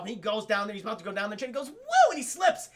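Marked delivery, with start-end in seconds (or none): 1.7-2.1 high, loud yelp; cartoon slip cry; mock slipping whoop. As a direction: whoop as your feet fly out. Imitating a slip.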